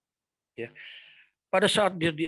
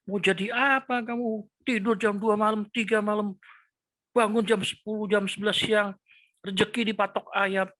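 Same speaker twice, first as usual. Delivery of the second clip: much higher, nagging reproach; mock scolding nag; 7 semitones higher than usual. Devil's voice scolding a late sleeper, mocking. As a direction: play the accuser who sneers at a lazy son.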